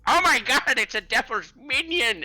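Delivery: a frantic, bone-robbed geezer crying out; shrill hysterical wail of a ranting elder; crazed old man. Acted.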